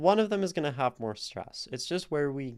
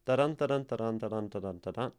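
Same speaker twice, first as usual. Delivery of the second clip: six "da-dun" beats in one descending run; six iambs; much faster than usual. Shown as a demonstration. Teacher sounding out iambic hexameter, running iambic beat. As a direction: chain "da-dun" pairs together, weight on "dun". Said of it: "which would just be".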